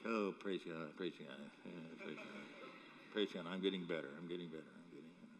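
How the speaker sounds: low, soft, out-of-breath muttering; mock-winded self-encouragement; jogger's breathless prayer. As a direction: pant out praise while jogging, fading away. Acts self praying while jogging.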